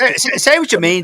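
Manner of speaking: high, loud teasing call-out. Real, not acted. Host goading panellist Dave, high loud teasing call. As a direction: egg him on, loud and high.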